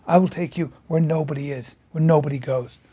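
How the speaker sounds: low, quietly voiced guide leading him away; hushed promise. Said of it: "he goes".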